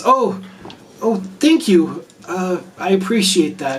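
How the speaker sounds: high, hesitant grateful elf; flattered surprise. Acted. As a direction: stammer thanks for an unexpected compliment.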